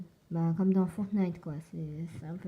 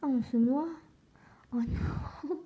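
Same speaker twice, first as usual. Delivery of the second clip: alarmed; 7 semitones higher, 3 dB louder, slower than usual. Real, not acted. Character is drowning in game.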